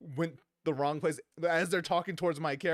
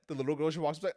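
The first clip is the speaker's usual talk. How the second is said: ordinary talk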